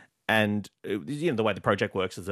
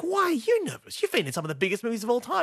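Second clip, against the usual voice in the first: high, fast disbelieving question; you can't believe he's nervous; mock astonished fan; incredulous; 9 semitones higher than usual. Excited retort to nervous star.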